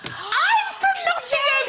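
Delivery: very high, drawn-out mock shriek; overexcited recognition. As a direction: squeal it like finding a long-lost neighbour. Overexcited discovery of a fellow local.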